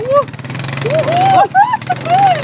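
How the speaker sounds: high gleeful whoop; excited whoop. Real, not acted. Thrill of driving through mud.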